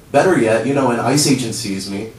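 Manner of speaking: usual speaking voice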